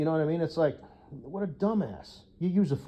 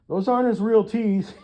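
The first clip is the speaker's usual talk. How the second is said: high, loud, teasing warning to the guests; mock warning; 7 semitones higher, 7 dB louder than usual. Writing to the guests about fake teeth, a loud high teasing warning. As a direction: sell the gag with a loud high tease. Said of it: "and be like".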